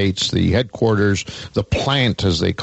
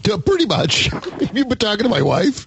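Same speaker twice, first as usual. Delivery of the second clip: high-pitched, amused reply through laughter; laughing; 12 semitones higher than usual. Real, not acted. Host teased about his unfinished deck, laughing. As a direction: laugh your way through the admission.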